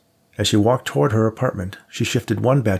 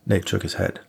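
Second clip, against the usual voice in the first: usual speaking voice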